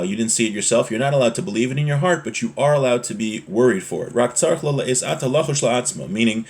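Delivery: normal talking voice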